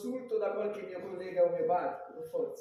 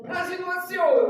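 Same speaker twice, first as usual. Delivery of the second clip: loud; 8 semitones higher, 10 dB louder, slower than usual. Acted. Shouted reproach.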